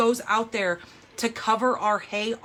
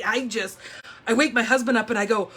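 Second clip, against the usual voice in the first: ordinary talk, nothing marked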